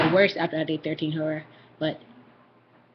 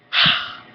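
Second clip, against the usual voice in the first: loud voiceless breath blast; imitated ghostly whispered shout; whispered yell; 6 dB louder than usual. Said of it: "deep whispered voice yelled".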